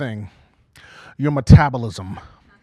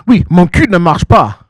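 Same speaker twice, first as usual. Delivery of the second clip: French; 8 dB louder than usual. Retold or imitated. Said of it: "she says it in French".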